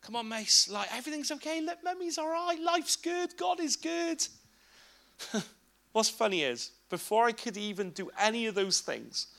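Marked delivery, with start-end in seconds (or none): 0.0-4.3 soothing dad. Retold or imitated. Father comforting his three-year-old, gentle and high. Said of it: "is just go".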